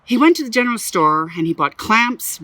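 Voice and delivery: ordinary delivery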